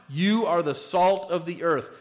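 "You" hit hard and loud, then the rest falls away; emphatic you. Shown as a demonstration. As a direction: punch "You" like a command. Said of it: "It's an emphatic you".

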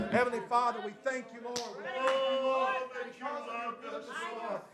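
loud, high, full-force praying aloud; impassioned prayer. Real, not acted. Preacher thanking God before the congregation, impassioned prayer. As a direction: cry out to God, drawing out the key words.

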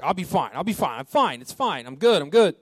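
hammed-up exasperated insistence, pitch swooping up and down; retold raging. Raging newlywed husband brushing off his wife, mock exasperated. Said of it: "And so he's raging".

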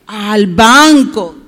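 slow, loud call-and-response answer; drawn-out prompt. Shown as a demonstration. Teacher prompts the children to answer "al banco", drawn-out and loud. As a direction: stretch BANCO out loud for repeating.

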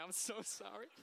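high, giggly apology; laughing apology. Real, not acted. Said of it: "I almost forgot your name".

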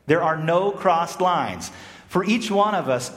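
normal talking voice

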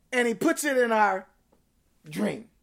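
ordinary delivery

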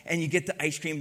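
ordinary delivery

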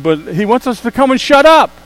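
loud, high burst on shut up; punchline shout. Acted. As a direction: land the punchline big. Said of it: "are you ready for this one?".

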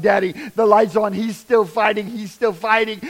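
cling to the news that he's still alive; urgent hope. Retold or imitated. Ambulance lights come back on.

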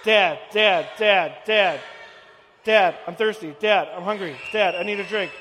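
put-on loud, nagging kid demanding; whiny kid. Pastor voices his kids pestering, loud whiny nagging. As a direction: pester dad over and over. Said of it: "someone pulling on your leg saying".

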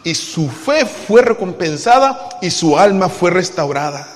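ordinary talk

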